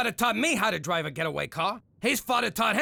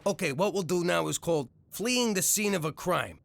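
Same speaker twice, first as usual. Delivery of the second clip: ordinary delivery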